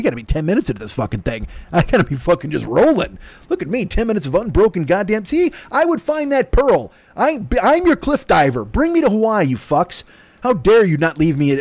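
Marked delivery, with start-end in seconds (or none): 1.7-11.6 mock boastful. Mock-boastful cliff diver rant.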